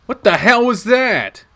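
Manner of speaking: startled demand. Acted. Protagonist confronts Shuichi after the fight, startled demand. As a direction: demand an answer, shaken. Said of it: "My voice comes out a little higher".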